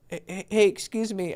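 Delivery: imitating a hesitant, stammered opener, soft; timid mumble. Coach mimicking client's emotionless approach to a girl, timid. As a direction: stutter out a weak, toneless approach. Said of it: "you're saying like this".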